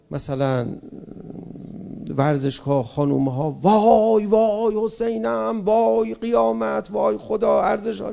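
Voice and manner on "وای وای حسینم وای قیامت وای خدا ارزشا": a lightly voiced, mock-horrified zealot crying وای; mocking zealot wail. Mocking religious hardliners wailing about women in stadiums, mock lament. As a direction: parody the pious outcry, half-hearted lament. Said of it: "کسی گفت".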